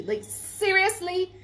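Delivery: slow, high incredulous question; disbelief. Real, not acted. Vlogger asking in disbelief about the ghost plan. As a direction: drag it out in disbelief. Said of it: "disbelief at the listeners".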